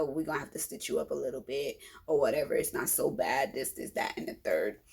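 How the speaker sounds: low, fast, fading to a near whisper; offhand doctor. Retold or imitated. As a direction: brush it off like a casual doctor. Doctor explains stitches casually.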